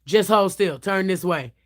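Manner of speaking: low, gruff no-nonsense barber; a lightly voiced tough barber, low; masculine barber voice. Barber telling client to hold still, low and gruff.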